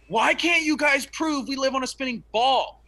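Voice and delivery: high, loud imitated yelling at a crowd; mock yell. Retelling Owen Benjamin yelling at his audience, loud mock yell. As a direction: belt it at the audience like a ranting showman. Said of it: "He started yelling at his audience".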